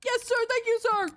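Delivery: put-on high-pitched obedient boy; little boy voice. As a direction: chirp it like a small boy. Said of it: "my little boy voice".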